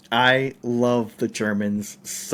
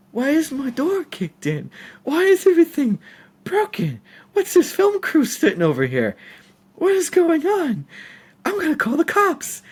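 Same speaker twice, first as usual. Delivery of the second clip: much higher, voicing an upset old lady; high-pitched old lady voice. Storyteller mimics the old lady finding her door kicked in, high and outraged. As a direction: squeal it like a shocked old woman whose door was kicked in. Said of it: "she's like".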